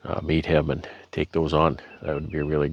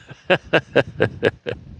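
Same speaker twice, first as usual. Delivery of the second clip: short laugh; 7 semitones higher than usual. Real, not acted. Embarrassed after mock entitled quote.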